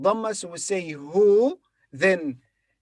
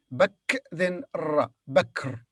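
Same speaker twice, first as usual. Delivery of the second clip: ordinary talk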